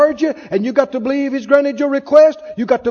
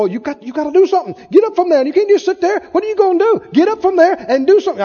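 higher, louder pushy nagging; nagging devil; 6 semitones higher, 3 dB louder, faster than usual. Retold or imitated. The devil pestering a resting believer, high and pushy. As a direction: badger them to get up and act. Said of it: "the devil will come".

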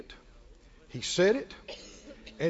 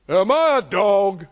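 a louder, scornful giant's sneer; mocking giant; 6 dB louder than usual. The Philistine sneering at young David, loud scorn. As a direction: jeer like a giant who feels insulted. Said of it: "And the Philistine said to David".

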